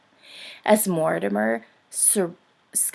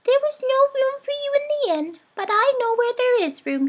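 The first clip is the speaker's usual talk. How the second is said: much higher, faster little mouse voice; tiny mouse making a plan; squeaky mouse; 4 dB louder, faster than usual. A narrator's reading. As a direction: mouse voice, quick and eager. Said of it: "he said".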